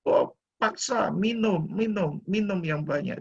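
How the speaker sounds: doctor urging. Retold or imitated. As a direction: press him to drink, a hint of the doctor. Doctor urging him to drink.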